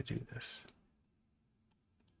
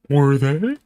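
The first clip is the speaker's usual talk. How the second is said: surprised gasp; 5 semitones higher, 15 dB louder than usual. Real, not acted. Gasps at screen.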